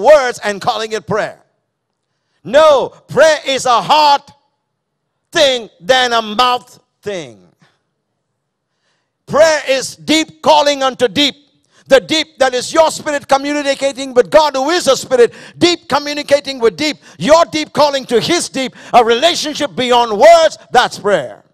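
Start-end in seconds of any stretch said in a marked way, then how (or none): 2.5-7.5 forceful preaching. Acted. Preacher rises to full force. 9.3-21.4 impassioned preaching. Acted. Preacher builds intensity.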